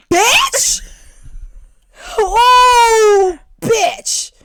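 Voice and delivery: high, loud, drawn-out wail; outraged scream. Real, not acted. Sister finding her headphones renamed Melvin's Beats, outraged shrieking.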